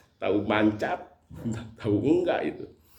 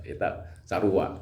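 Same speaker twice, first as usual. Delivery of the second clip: ordinary talk, nothing marked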